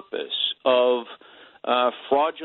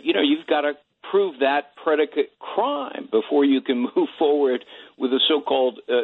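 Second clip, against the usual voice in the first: ordinary talk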